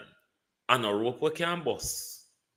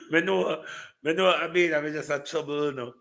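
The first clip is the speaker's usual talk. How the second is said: teasing laugh; 6 semitones higher, faster than usual. Real, not acted.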